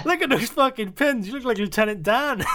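high-pitched imitated heckle; hammed-up brotherly mockery; mocking banter. Imitating brothers teasing him online.

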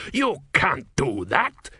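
slow, indignant outburst; angry outburst. A narrator's reading. Argavisti objecting to talk of surrender, exploding. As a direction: explode in protest, words stretched out. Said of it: "Argavisti exploded".

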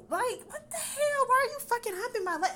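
high, loud exasperated yell at the guy; retold outrage. To her date humping her leg at the bar, high loud outrage. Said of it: "And I'm like".

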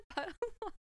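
giggling through the words; can't keep a straight face; laughing while reading. Real, not acted.

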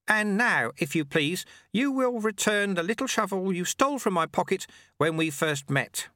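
put-on high, chirpy old man's voice; amused little old man teasing; old sweeper. A narrator's reading. Old sweeper gently mocks the shamed challenger, high and chirpy.

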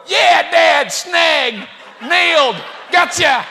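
put-on high, loud taunting kid voice; shouted mock triumph, word by word; gloating boys. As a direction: jeer gleefully like sons catching Dad out. Gleeful sons taunting their dad.

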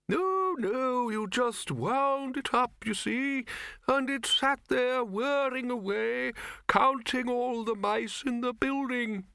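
put-on very high, slow, quavering old man; ancient wizard. Old wizard reminiscing from his wheelchair, doddery. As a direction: draw it out in a high old-codger voice.

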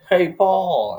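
high, loud mock greeting; calling out. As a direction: sing out the name to get his attention. Bateman calls out before killing.

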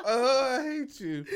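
high, drawn-out laughing wail; helpless laughter. Real, not acted. Co-host cracking up at the plant joke, real laughing wail. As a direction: wail it slowly through loud laughter.

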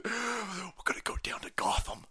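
breathy whispered joke. Real, not acted. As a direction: whisper it through a laugh. Joking aside.

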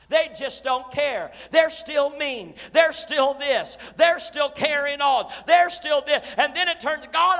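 rising, loud preacher's cry; loud, high preaching of a frustrated heart; preaching shout. Acted. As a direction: build the fire and shout it out.

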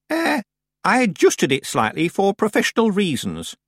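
put-on high-pitched old woman; defensive old witch. Miss Treason explaining her altered age, defensive. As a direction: brush off the fib airily.

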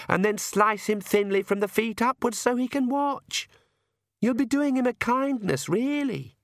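high-voiced teenager, casually sadistic; Lacrimosa, blithely cruel daughter. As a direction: reel off the list with rising relish. Narrator plays Lacrimosa.

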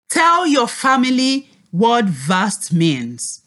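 usual speaking voice

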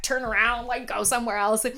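ordinary talk

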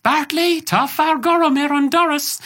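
high, slow quoted Irish line in Bartley's voice; film quote voice. Retold or imitated. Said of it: "It's like".

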